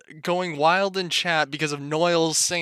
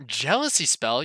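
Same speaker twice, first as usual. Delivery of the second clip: ordinary talk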